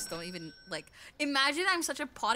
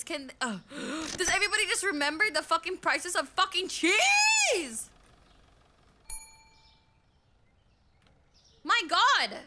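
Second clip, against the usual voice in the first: loud, higher, fed-up ranting with curses; exasperated rant; 8 semitones higher, 8 dB louder than usual. Real, not acted. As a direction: blow up at chat over the cheese complaints. Ranting at chat about cheese.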